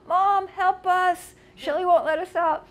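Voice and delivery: high, loud, drawn-out cry for help; playful imitation of children screaming for help; mock kids yelling. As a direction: wail for Mom like trapped kids. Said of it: "screaming and yelling for Mom".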